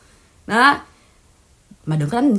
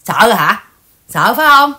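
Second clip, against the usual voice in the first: loud, jeering challenge; mocking taunt; 7 dB louder than usual. Real, not acted. Taunting the rival streamer, loud and jeering. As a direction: goad her like a coward.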